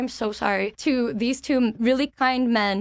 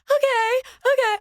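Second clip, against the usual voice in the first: flustered squeak; 13 semitones higher, 4 dB louder than usual. Retold or imitated. Flustered reply to cosplayer.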